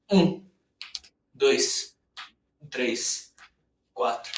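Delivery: strained counting mid push up; doing push ups. Real, not acted. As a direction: count reps through the effort. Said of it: "Vou fazer com o punho fechado". (contando flexões com esforço)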